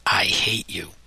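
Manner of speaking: whispered with no voice; a boy's voiceless whisper. A narrator's reading. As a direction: hiss it under your breath to your sister. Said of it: "and whispered".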